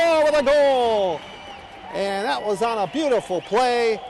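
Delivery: loud, high celebratory shout; excited shout. Real, not acted. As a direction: shout it out over the crowd. Celebrating the goal.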